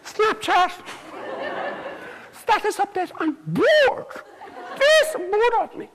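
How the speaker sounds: put-on high, whiny bored teen girl; bored princess.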